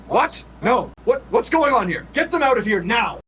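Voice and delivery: high, urgent character yell; alarmed, high-pitched shouted command; acted yell. A narrator's reading. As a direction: yell it in disbelief, then as an order. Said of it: "Sandrin yells".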